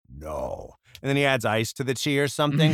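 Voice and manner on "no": much lower and much softer single no; lightly voiced sullen Sauron, low and quiet; low deadpan no. Sauron refuses, quoted reply.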